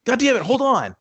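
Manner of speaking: a quick shout of frustration; sudden loud exclamation. Real, not acted. Blurting it out mid-story to a friend, loud. Outburst while telling, loud.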